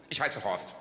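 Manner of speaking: tief, ruhig der Skilehrer; lässig tiefer Horst; tiefe Männerstimme. Acted. Horst nennt Vornamen. (Skilehrer zur Frau, tief gesprochen)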